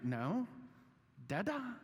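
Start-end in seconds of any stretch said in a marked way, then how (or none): 1.3-1.8 put-on broad singsong kids theatre. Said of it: "my children asked me".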